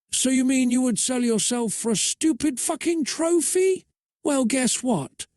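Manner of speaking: high-pitched, indignant challenge; indignant retort. A narrator's reading. Sasha answering Jade's accusation about the contest, indignant. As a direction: throw it back in disbelief, voice lifted high. Heated quarrel over a trophy.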